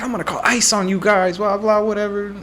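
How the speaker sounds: high-pitched imitation of an outraged woman; mock spazzing customer. Customer threatening the staff, mock high-pitched rant. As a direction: send up her tantrum in a high voice. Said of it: "this lady just starts spazzing".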